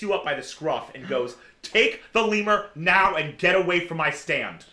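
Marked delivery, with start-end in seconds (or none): 1.6-4.7 raised, higher, angry order; loud, angry shopkeeper telling off a customer; really angry. Acted. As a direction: order them off angrily, voice up and loud. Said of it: "Really angrily".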